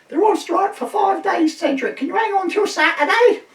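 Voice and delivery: a wife shouting the phone news to her husband, high and loud; shrill wife. Retold or imitated. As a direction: belt it across the room in a high put-on voice. Wife relays, shrieking.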